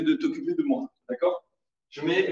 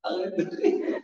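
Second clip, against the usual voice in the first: stifled laugh; 3 semitones higher, 4 dB quieter, slower than usual. Real, not acted.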